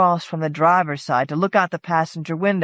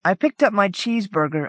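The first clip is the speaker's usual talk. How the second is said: plain reading voice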